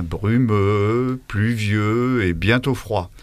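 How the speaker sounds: ralentir, peser chaque mot sombre; étirer brumeux, pluvieux, froids; lent et appuyé. Shown as a demonstration. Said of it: "j'ai attiré votre attention". (ton faussement lugubre, très lent)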